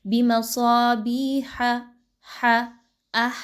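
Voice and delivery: بِمَصَابِيحَ ending on a breathy throat ح, slow; clear ha. Shown as a demonstration. Teacher drills the ح articulation for students. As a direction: isolate the ح sound from the throat. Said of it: "بہت اچھے سے ہا کا مخرج کو پڑھیے".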